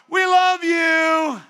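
slow, high, loud call of love; calling out tagline. Retold or imitated. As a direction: shout it after the people leaving. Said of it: "we're always saying this tagline now".

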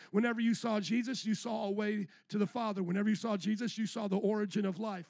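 ordinary talk, nothing marked

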